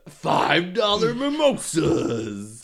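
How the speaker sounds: slow, loud announcer hype; drag brunch ad. Acted. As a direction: stretch it like a radio promo.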